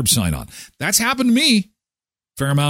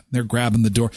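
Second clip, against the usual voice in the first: usual speaking voice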